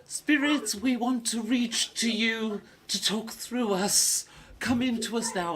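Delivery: high, slow theatrical séance wail; wailing medium. Acted. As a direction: croon to the spirits like a fake psychic. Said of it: "she goes through all the wailing".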